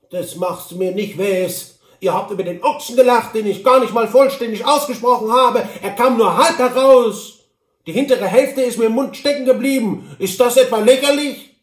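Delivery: high, loud, pompous little man in a huff; indignant Hobble Frank. Acted. Hobble Frank answers from his horse, loud and offended. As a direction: declaim your offended dignity loudly.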